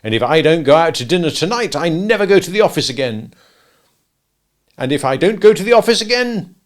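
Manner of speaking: loud, stern escalating threats; sternly. Acted. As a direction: lay down the law to his wife. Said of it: "he went on sternly".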